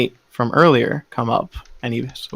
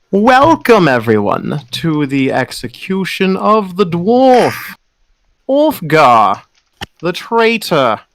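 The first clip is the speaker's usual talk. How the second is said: booming preacher; 5 semitones higher, slower than usual. Acted. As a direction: announce it grandly to the whole square. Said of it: "his voice booms".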